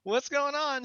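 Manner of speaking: overly cheerful greeting; mock friendly greeting. Him greeting the arriving police, high and cheery. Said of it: "and I'm like".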